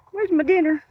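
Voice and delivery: high squeaky cat voice; kitten voice. Pretend. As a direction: voice the hungry kitty. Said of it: "He's like".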